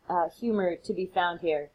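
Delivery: usual speaking voice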